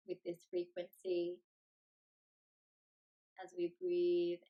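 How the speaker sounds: unhurried meditation voice with long pauses; guided meditation. Acted. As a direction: lead them gently into stillness, phrase by phrase.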